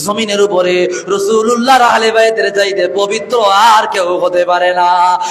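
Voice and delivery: ordinary talk, nothing marked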